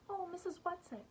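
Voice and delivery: high-pitched despairing plea; despairing call. A narrator's reading. Meg pleads for her absent helper, despairingly. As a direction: cry out for rescue, pitched high. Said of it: "Meg called despairingly".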